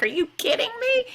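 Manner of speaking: high, breathless whispered disbelief; incredulous whisper. Retold or imitated. Retelling her reaction to the lockdown, a high whisper. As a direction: hiss it out in shocked disbelief, all breath.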